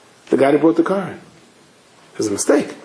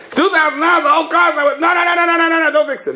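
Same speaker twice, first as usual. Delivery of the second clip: high, yelping no no no; panicked refusal. Retold or imitated. Owner panicking at the mechanic over two thousand dollars, high yelping. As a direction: cry out in alarm at the price.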